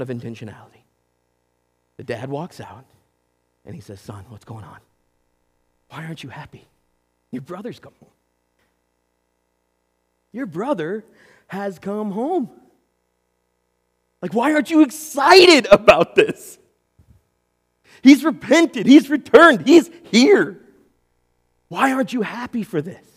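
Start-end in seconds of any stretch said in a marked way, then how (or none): 4.0-12.5 soft, gentle father coaxing his son; a father quietly reaching out to a sulking son; softly asking what is wrong; tender father. Acted. Father gently approaches the older son. 14.2-23.1 higher and louder, urging the son to rejoice; father pleading with rising excitement; excited pleading father. Acted.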